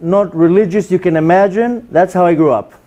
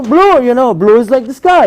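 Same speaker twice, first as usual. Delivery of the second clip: higher-pitched mock explainer; acting out a patient explanation, pitched up; lightly acted explainer, raised pitch; explaining to a blind man; 5 semitones higher than usual. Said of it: "And you tell him".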